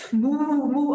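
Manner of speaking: high, drawn-out moo; plaintive mooing in a storyteller's voice; imitated mooing. A narrator's reading. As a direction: moo like a woman imitating a cow. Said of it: "The woman moos".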